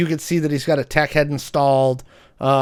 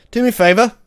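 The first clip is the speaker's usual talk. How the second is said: put-on British accent; 5 semitones higher, much faster than usual. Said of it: "The British guy goes".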